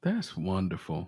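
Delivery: usual speaking voice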